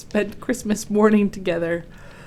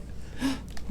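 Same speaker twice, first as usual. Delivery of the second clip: gasp; 7 semitones higher, 8 dB quieter than usual. Acted. Gasp while reading aloud.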